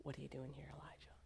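soft unvoiced whisper; God asking gently in a whisper; whispered question. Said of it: "the Lord asks him the same question".

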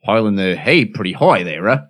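lightly put-on British bloke; British accent. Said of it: "he said in a normal voice".

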